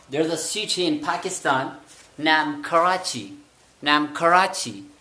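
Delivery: slow English with कराची said khərachi; English accent. Shown as a demonstration. Teacher modelling English accent with Karachi. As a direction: speak slowly, aspirating कराची. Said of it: "के को आपने sound दे दिया ख का".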